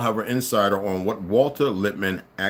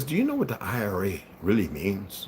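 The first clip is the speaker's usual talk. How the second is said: put-on quoting an old woman in a soft, low Irish accent; a quiet Irish accent, lower and softer; 5 semitones lower, 7 dB quieter than usual. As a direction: put on her Irish lilt for the question. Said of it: "In her accent".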